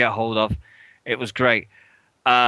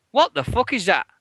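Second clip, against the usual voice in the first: raised pitch, mock-horrified; aghast exclamation; 9 semitones higher than usual. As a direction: act out the horror at the shirt. Retold shock on opening box.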